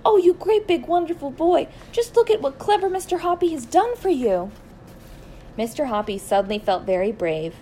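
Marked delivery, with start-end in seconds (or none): 0.0-4.5 high, loud gushing over a pet; lavish praise in a soaring pitch; delighted cry. A narrator's reading. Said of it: "she cried".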